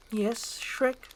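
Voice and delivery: lightly voiced princess, gentle; tender Fiona. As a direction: answer hopefully.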